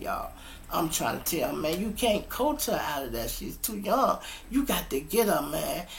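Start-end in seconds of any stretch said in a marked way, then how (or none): 1.6-5.9 retold arguing. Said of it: "Me and him is in an argument".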